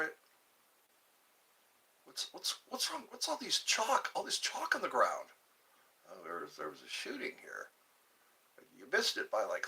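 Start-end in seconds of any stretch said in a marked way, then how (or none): 2.0-5.3 high puzzled voice; mildly hammed-up confusion; confused bystander. Acting confused late arrival. 6.1-7.7 low, soft matter-of-fact answer; a bored local explains it; lightly voiced low answer; deadpan reply.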